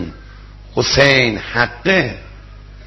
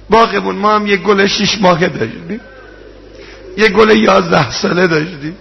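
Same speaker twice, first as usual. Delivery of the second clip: raised, tearful rowze recital to the congregation; mourning sermon; 5 semitones higher than usual. Acted. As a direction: preach the tragedy at full force, voice straining. Rowze mourning recital at full force.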